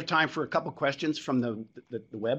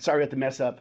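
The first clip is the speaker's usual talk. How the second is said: usual speaking voice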